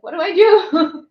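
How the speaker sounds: high, loud, laughing mock panic; panicked rider. Streamer imitating a beginner rider panicking, laughing. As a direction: whine it like a helpless beginner.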